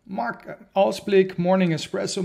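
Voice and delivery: strained, failing voice; voice giving out. Real, not acted. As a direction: voice giving out on him. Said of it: "meine Stimme versagt jetzt schon unglaublich".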